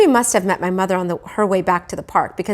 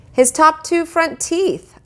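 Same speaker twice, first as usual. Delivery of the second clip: put-on boy's voice; 10 semitones higher, 3 dB louder, slower than usual. A narrator's reading. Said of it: "I said".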